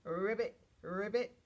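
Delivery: loud ribbit with rolled start; punchy frog imitation; frog croak. Shown as a demonstration. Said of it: "Can you make that sound".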